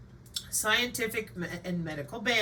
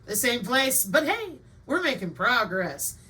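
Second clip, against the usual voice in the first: normal talking voice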